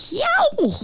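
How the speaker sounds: high startled yelp; high, sharp "yow"; startled yowl. A narrator's reading. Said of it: "oh, the noise it made".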